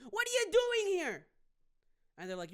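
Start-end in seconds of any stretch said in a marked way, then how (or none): 0.1-1.3 high, loud challenging yell; confrontational shout. Retold or imitated. Man confronting ice agents with his phone, loud high challenge. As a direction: get right in their face demanding answers. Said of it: "Phone all up in their face".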